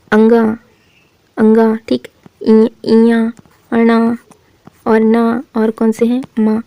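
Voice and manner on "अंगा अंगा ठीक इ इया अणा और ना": अंगा, इया, अणा said through the nose; nasal sounds demo. Shown as a demonstration. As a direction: sound each nasal clearly and separately. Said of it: "जैसे कौन कौन से होते हैं अनुनासिक व्यंजन". (नाक से उच्चारण करके दिखाना)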